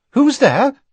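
high, nervous call through the door. A narrator's reading. Mrs Weasley at night, calling through the door nervously. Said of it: "said a nervous voice".

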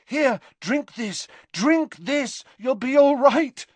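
high, urgent coaxing; desperate. A narrator's reading. Harry coaxes the professor to drink, desperately. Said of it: "said Harry desperately".

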